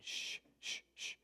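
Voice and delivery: soft unvoiced "sh" hush; sh sound demo. Shown as a demonstration. Teacher hushing "sh" to show the sound.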